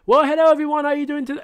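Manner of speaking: squeaky loud streamer greeting; high loud old voice. Retold or imitated. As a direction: belt the hello up high. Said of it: "I used to, like, talk like".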